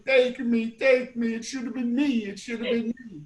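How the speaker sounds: imitating a high, loud graveside wail; hammed-up mock lament. Brother mimicking aunt climbing into the casket, mock wailing.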